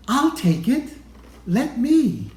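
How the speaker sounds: put-on high, eager kid voice offering help; bright and keen to please.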